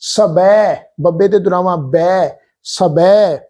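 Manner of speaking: ਸਬੈ with a full rounded ਬੈ; full ai vowel. Shown as a demonstration. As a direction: open ਸਬੈ wide at the end. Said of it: "ਐ ਕਰਕੇ ਉਚਾਰਨ ਕਰਾਂਗੇ".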